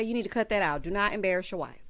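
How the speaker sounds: ordinary talk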